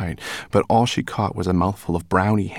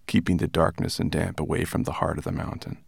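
ordinary delivery

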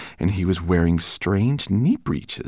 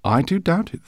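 higher, mildly amused scholar; a gentle professor who disagrees; gently amused professor, higher voice; Greenbloom; 13 semitones higher, 5 dB louder than usual. Acted. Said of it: "said a tall, thin man".

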